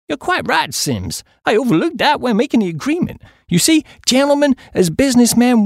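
high-pitched, reasonable businessman; water supply chappie. A narrator's reading. Chappie explaining the committee's rights, reasonable and businesslike. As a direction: pitch it up and argue like a committee man.

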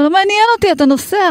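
a panicked mother overriding the teachers; frantic insistence. Retold or imitated. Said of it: "אני אומרת לה". (אם בפאניקה מתעקשת מול המורות, בקול גבוה מאוד)

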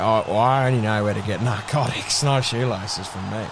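very low, monotone player voice; deep-voiced bloke, puzzled and evasive; cagey footballer dealer. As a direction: answer warily in a deep drone. Plays mic'd-up player asked about shoelaces.

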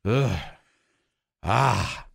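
ordinary delivery

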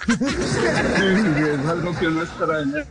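slow words through laughter; laughing. Real, not acted. Guest still laughing at the joke, slow and amused. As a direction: still laughing while speaking.

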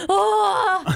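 high, loud wail of fake injury; mock pained cry. Wife faking injury to prank her husband, pained wail. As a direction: cry out as if your back just broke. Acting out faked back injury.

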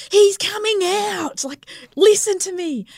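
very high re-enacted desperation; frantic insistence, retold. Labouring mother insisting to be listened to, retold frantic insistence. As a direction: insist in a high mock wail.

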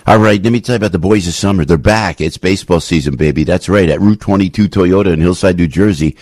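upbeat host-read commercial; radio ad read. Acted. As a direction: sell it like a radio ad. Live read ad.

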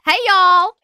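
a cheery Southern American welcome; Southern American accent. Pretend. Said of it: "learn Southern American".

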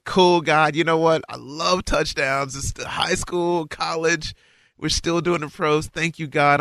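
high excited gratitude to God; giddy thanks. Retold or imitated. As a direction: gush like an eager kid.

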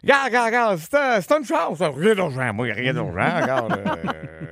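voix aiguë et traînante d'un ami; message vocal de chum. Retold or imitated. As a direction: imiter un ami qui laisse un message vide. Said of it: "puis que ton message, c'est". (voix aiguë de chum au répondeur)

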